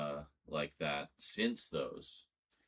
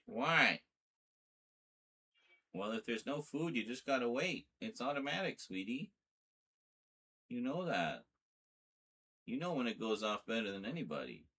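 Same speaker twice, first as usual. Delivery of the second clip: higher, gently reasoning pet talk; talking to cat; 6 semitones higher than usual. Acted. Streamer explaining the automatic feeder to her cat, higher pet talk. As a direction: patiently explain it to the cat, pitched up.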